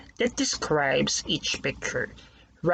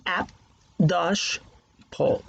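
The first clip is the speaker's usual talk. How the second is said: "Ap" then "dash" then "pole", each beat spoken separately; teacher spelling out syllables for kids; syllables split apart. Shown as a demonstration. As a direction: break Apple into its two beats with a pause between. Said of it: "So that's the syllabication".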